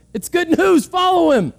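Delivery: high, bright, laughing urging; laughing exhortation. Real, not acted. Preacher urging listeners to follow Christ, excited with a laugh. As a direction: urge them on with a laugh.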